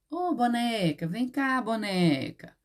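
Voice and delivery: playful demonstration of calling "boneca", middle syllable drawn out. Shown as a demonstration. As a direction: beckon the "boneca" over from a distance, stretching "ne". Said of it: "Como que a tia chamaria a palavra boneca?".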